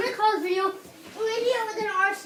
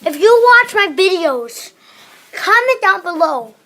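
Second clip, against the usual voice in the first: loud disclaimer; 9 dB louder than usual. Real, not acted. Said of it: "I want to just give out a disclaimer".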